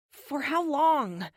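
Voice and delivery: high, slow, drawn-out whine; whining. Acted. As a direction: complain like a sulky child. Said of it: "I try not to sound like I'm whining, but I fail".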